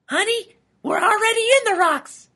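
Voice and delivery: loud, very high-pitched wife; high cartoon holler; shouting back. A narrator's reading. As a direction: shout it back to your panicking husband. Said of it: "Lila shouted back".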